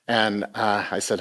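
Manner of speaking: ordinary talk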